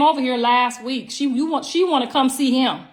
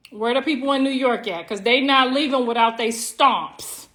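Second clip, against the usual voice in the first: ordinary talk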